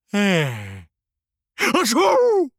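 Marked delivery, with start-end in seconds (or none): 1.6-2.5 high loud achoo; big sneeze. Acted. Flamingo sneezes.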